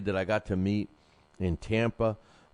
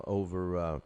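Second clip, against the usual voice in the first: ordinary talk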